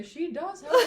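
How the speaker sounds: high, loud sassy quip. Retold or imitated. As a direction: toss it off while checking your nails. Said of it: "Very sassy".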